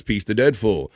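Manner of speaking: ordinary talk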